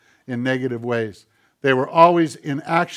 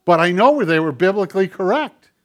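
impassioned sermon delivery; preaching; 6 semitones higher, 8 dB louder than usual. Acted. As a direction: preach it with rising fervor. Preaching to congregation.

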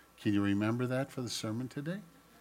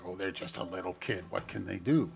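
dismissive thought; 4 semitones lower, 3 dB quieter than usual. Retold or imitated. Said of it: "we think".

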